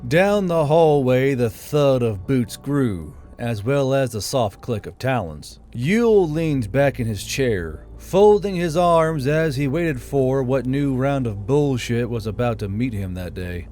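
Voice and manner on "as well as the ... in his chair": usual speaking voice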